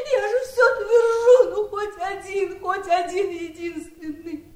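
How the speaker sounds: high, weeping with broken laughs; sobbing laugh of a ruined life; crying. Acted. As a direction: let the tears break through the words. Aunt breaks down over wasted years.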